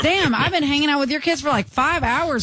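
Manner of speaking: exasperated complaint. Retold or imitated. Sibling left babysitting confronts those coming back, exasperated.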